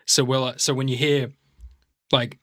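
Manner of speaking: ordinary talk, nothing marked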